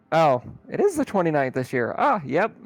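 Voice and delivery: usual speaking voice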